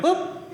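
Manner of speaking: short high startled "Oop"; a quick surprised yelp of "Oop"; tiny high startled yelp. Retold or imitated. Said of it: "You know that "oop" noise".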